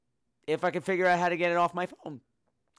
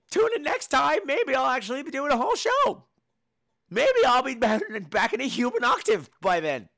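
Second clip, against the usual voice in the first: strained-high show outro; pitched way up, out of normal octave; high-pitched sign-off; 8 semitones higher, 4 dB louder than usual. Real, not acted. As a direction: push the voice up high to wrap the show.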